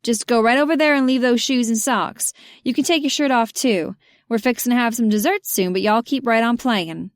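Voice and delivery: a hospitable Southern hostess; Keely sending Andy off to play, in a thick Southern accent. Acted. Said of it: "with a thick southern accent".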